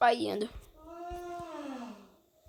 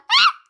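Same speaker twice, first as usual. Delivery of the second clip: a very high loud startled scream; sudden scream; 9 dB louder than usual. Real, not acted. Gamer caught off guard in the match, high startled scream. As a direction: scream in shock.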